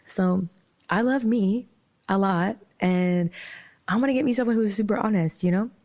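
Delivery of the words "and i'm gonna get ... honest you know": normal talking voice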